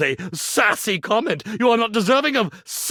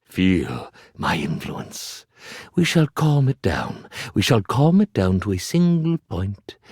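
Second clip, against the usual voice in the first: hypnotist voice; 10 semitones lower than usual. Acted. Magician hypnotising his audience, low and lulling. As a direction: lull them into a trance.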